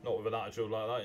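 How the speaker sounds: ordinary talk, nothing marked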